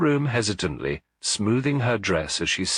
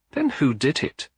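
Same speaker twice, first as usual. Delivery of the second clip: higher, insistent demand; 9 semitones higher than usual. A narrator's reading. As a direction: press him for the name. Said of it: "Camilla demanded".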